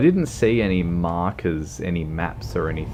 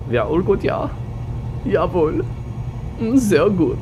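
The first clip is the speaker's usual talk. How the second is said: hearty mock German exclamations; hammed-up German; 12 semitones higher than usual. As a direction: play an over-the-top jolly German. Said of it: "very German".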